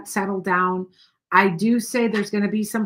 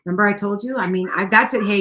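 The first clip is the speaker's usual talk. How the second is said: ordinary delivery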